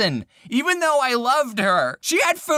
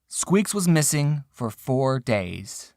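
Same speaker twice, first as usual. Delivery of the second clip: dramatic slow; 7 semitones lower, much slower than usual. Acted. Grim reveal of missing hamster.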